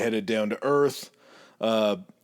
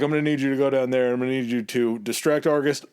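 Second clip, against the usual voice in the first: rapid orders; much faster than usual. Retold or imitated. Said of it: "It's like".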